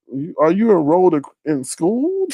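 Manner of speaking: usual speaking voice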